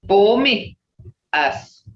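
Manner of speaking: ordinary talk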